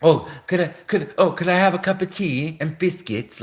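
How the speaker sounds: imitating a posh Brit asking for tea in a hammed-up British accent; self-mocking bad British accent. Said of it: "They, they say I sound like".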